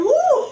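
a loud, high Woo; a real celebratory whoop; high cheer. Real, not acted. Cheering for energy.

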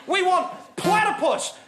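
rally the crowd for platypus; excited shout. Acted. Ranting comic rallying cry.

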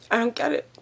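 tearful, quiet and breaking; words through tears; crying. Real, not acted. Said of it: "The waterworks start".

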